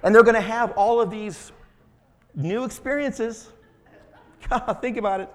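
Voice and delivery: real giggles breaking into the sentence; laughing through words. Real, not acted. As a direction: laugh it out, barely holding it together. Preacher breaks up over his joke.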